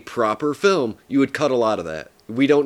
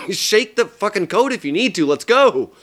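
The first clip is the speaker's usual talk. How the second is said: higher, louder, urging someone on; urgent frustration; 7 semitones higher, 4 dB louder than usual. Retold or imitated. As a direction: hurry them, exasperated. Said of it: "you have that feeling of".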